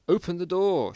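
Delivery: loud call to open the door; shouted. Acted. Mike shouting to his friend to open the door. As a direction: yell it over the noise. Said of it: "shouted Mike".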